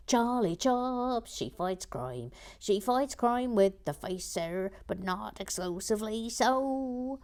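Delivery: loud, slow singsong announcement; theatrical catchphrase intro. Acted. Host introducing a crime-fighting viewer, slow theatrical singsong. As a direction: stretch the words and climb to a held ending.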